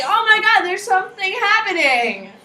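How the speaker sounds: flustered Italy. Retold or imitated. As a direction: squeal it like a flustered, excited Italy. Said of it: "Italy's like".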